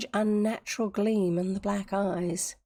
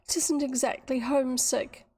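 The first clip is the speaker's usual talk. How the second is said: higher, tearful voice, lightly acted; a touch of misery; sobbing; 6 semitones higher than usual. A narrator's reading. Miserable Dorothy to Tavia, mildly sobbing. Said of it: "sobbed the miserable girl".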